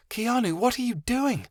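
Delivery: put-on high, bright young girl voice; lightly voiced young girl asking; girl character voice. Chameleon's character voice.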